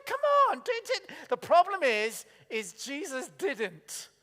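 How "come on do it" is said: much higher, louder fed-up cry; impatient sympathetic outburst; exasperated. Retold or imitated. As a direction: urge on impatiently. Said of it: "You're just going".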